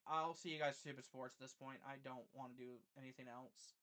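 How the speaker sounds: very soft, low, worn-out mumble; dejected sign-off. Real, not acted. Streamer telling viewers he can't go on, dejected sign-off. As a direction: give up quietly, no fight left.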